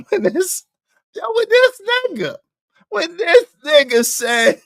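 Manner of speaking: squealing through laughter; high laughing disbelief. Real, not acted.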